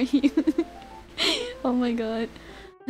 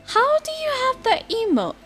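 squeaky high voice; 13 semitones higher than usual. Real, not acted. Squeaky high surprised question.

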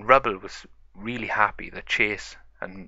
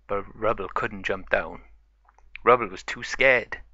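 ordinary delivery, nothing marked

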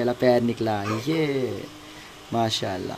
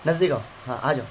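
ordinary delivery

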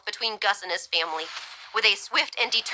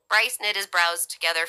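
ordinary delivery, nothing marked

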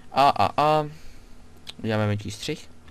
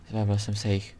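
usual speaking voice

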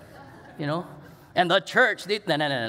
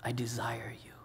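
the same words, much softer, almost breathed; Jesus hushed; 6 semitones lower, 11 dB quieter than usual. Retold or imitated. Jesus repeating his desire to his friends, hushed. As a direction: let it sink to a hush. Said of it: "It's when Jesus says".

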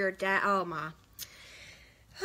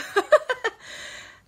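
high laugh; 14 semitones higher, 5 dB louder than usual. Real, not acted. Laughing at a comment.

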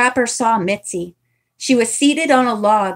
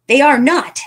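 loud, higher denial; retorted; 5 semitones higher, 5 dB louder than usual. Acted. Mitzi retorts to Nancy's accusation, loud and sharp. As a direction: fire the denial right back. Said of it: "Mitzi retorted".

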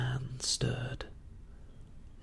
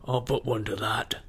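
put-on old seaman cries out. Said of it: "cried the old mahogany-faced seaman".

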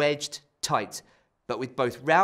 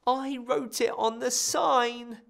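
wailing; 9 semitones higher, slower than usual. Acted. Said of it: "Ralph wailed".

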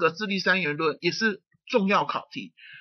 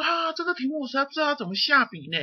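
higher, quicker voice of a student at a loss; puzzled student; 7 semitones higher, faster than usual. Acted. Teacher voices a student who can't start the exam essay, puzzled. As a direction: lightly act out a student stuck on the question. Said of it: "所以你会说".

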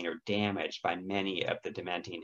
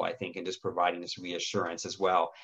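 ordinary delivery

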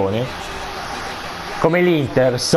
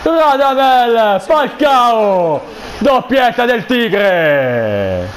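goal celebration shout; 7 semitones higher, much slower than usual. Real, not acted. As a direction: draw out the name like a commentator. Second goal call. (urlo del gol in telecronaca)

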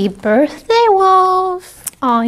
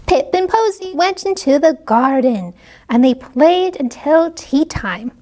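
plain reading voice

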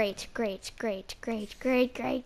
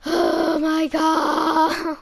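much louder, very slow; drawn-out shock; 2 semitones higher, 11 dB louder, much slower than usual. Real, not acted. After the fight, a slow loud gasp. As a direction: stretch it in shock.

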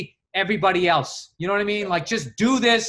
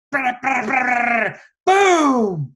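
rising brr brr then a loud boom; fireworks imitation; 5 semitones higher, 7 dB louder, much slower than usual. Father imitating fireworks at four in the morning, loud boom. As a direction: mimic exploding fireworks.

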